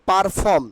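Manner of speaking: "পারফর্ম" said par-phom with a sounded r; the wrong "পারফর্ম", r clearly in "par"; common mispronunciation. Shown as a demonstration. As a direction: say "পারফর্ম" with the r after the first vowel. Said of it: "আমরা কি বলি?".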